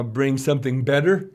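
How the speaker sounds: ordinary talk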